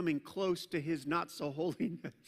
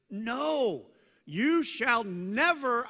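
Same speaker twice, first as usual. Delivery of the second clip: loud, slow emphatic no; emphatic refusal; 5 semitones higher, 7 dB louder, much slower than usual. Retold or imitated. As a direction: insist firmly, drawing it out. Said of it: "it's pretty emphatic".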